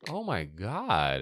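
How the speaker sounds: ordinary talk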